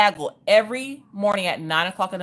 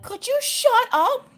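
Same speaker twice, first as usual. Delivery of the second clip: put-on high, slow, fed-up little girl; 3 dB louder, slower than usual. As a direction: drag it out like a shy kid finally snapping. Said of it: "Maggie was just like".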